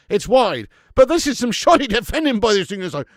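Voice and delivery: high, loud, breathless play-by-play; horrified fan calling the attack; frantic live commentary. Real, not acted. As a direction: yell every move as it happens. Live alarm at New Zealand chances.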